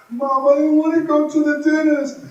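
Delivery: put-on high child's voice refusing; little kid whining. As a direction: whine like a scared little boy. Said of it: "mom said".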